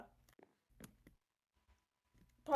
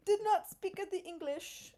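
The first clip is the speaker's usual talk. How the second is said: put-on higher-pitched broken English in a French accent; mock French accent; 9 semitones higher, 4 dB louder than usual. Imitating French security refusing English, mock French accent. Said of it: "They're like".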